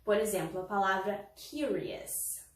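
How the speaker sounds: ordinary delivery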